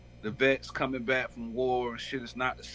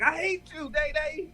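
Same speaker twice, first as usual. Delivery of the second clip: mock fan yell; 4 dB louder than usual.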